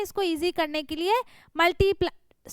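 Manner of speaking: usual speaking voice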